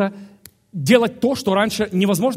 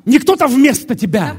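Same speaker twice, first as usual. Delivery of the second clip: loud, raised preacher's voice; emphatic preaching; 7 semitones higher, 9 dB louder than usual. Real, not acted. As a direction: drive it home loudly to the congregation. Preacher at full force.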